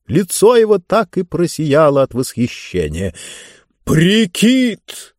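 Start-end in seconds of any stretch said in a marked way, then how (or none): 3.8-5.1 high, stretched-out relish; pompous ruler thrilled by a word; delighted repeat. A narrator's reading. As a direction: savour the new word with delight. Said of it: "с удовольствием повторил он".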